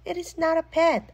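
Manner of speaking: high, loud protest; child yelling back at Mom; yelled back. A narrator's reading. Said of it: "I yelled back".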